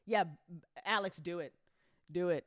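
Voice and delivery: ordinary delivery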